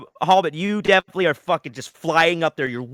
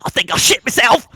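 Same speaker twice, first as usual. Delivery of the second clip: scared goblin; 8 semitones higher, 6 dB louder than usual. Acted. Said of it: "one of them go".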